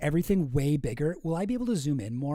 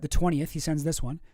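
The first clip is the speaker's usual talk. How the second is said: ordinary talk, nothing marked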